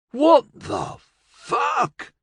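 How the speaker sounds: very high, slow, startled cry; Billy Joe gasps as his colleagues are trapped; shocked gasp. Acted. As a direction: caught off guard, gasping in fear. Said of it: "Billy Joe gasped".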